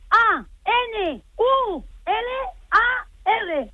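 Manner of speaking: letter by letter, slowly, with gaps; spelling it out. Real, not acted. Exasperated customer spelling anular for the clerk, letter by letter. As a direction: spell emphatically, one letter at a time. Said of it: "Se lo letreo".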